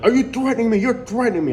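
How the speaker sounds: high, loud huffy accusation; mock indignant clerk. Imitating the city clerk on the phone, high and indignant. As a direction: squeal it like an offended official.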